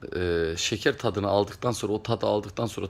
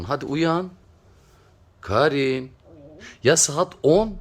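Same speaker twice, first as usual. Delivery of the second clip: calling out; 6 semitones higher, 4 dB louder, slower than usual. Real, not acted. Calling the sleeping pet.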